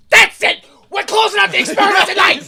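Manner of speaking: high, loud mock shout; fed-up outburst. Larry calling off the experiment, shouting in exasperation. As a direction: explode like someone who is completely done. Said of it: "He was done".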